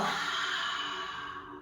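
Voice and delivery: long breathy "ha" sigh; ha exhale. Shown as a demonstration. Yoga teacher leading ha breaths, big sighing exhale. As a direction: let a big audible exhale pour out. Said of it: "Big inhale and then exhale".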